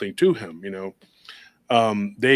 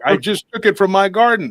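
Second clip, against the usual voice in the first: higher and louder, exasperated; exasperated protest; 9 semitones higher, 9 dB louder than usual. Retold or imitated. Fed up poster insisting the rose photo is real, exasperated. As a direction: protest your innocence, fed up. Exasperated retort to online doubters.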